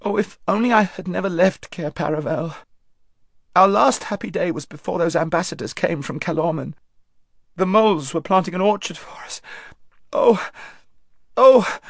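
a queen breaking down over her mistake; bursting into tears. Acted. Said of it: "bursting into tears".

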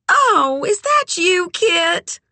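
very high, sugary greeting; extra sweet. A narrator's reading. Red recognises Kit on the phone, extra sweet. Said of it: "extra sweet".

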